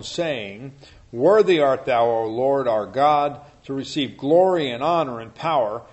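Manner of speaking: loud, solemn Bible reading; scripture reading. Acted. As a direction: proclaim it like a preacher. Reads Revelation aloud to group.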